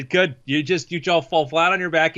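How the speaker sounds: ordinary delivery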